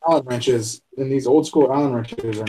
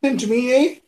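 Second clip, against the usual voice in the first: higher, jokey Canadian eh; mock Canadian; 9 semitones higher, faster than usual. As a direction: toss in a teasing Canadian eh. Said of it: "I'm stuck on the A part".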